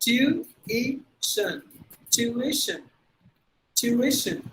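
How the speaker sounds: tuition as tyu-I-shun; three syllables. Shown as a demonstration. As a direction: separate all three beats of tuition. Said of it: "it's actually three syllables".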